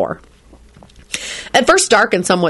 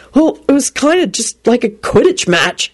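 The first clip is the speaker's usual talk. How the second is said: higher, slow, anxious excuse; desperate blurt; 6 semitones higher than usual. A narrator's reading. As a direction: scramble for a justification. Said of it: "Harry blurted out desperately".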